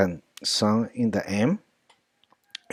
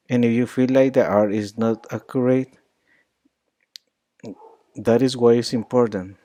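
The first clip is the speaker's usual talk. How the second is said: ordinary talk, nothing marked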